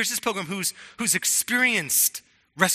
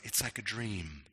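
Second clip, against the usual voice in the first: hushed wonder; 7 semitones lower, 11 dB quieter than usual. Retold or imitated. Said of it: "that he goes".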